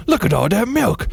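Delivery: high-pitched milk exclamation; voiceover outburst. Acted. Voice over an overflowing bowl, high exclamation. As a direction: pitch it up into a silly yelp. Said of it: "and a voice goes".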